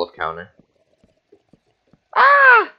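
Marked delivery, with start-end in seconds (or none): 2.2-2.7 a sudden, high, loud yelp. Real, not acted. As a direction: let out a startled squeal.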